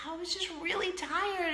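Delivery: normal talking voice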